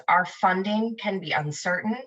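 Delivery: normal talking voice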